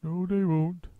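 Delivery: lightly voiced bird; a friendly bird's lilt; chirpy roly-poly bird. A narrator's reading. Roly-poly bird warning Muggle Wump gently.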